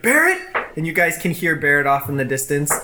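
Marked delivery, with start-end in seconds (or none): 0.0-0.4 high, loud call across the room. Acted. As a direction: shout his name to find him. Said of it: "he's like".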